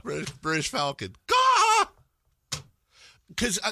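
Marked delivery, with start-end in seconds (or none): 1.3-1.9 loud, high cawing bird cry; high crow caw. Pretend. Host cawing loudly for the falcon team.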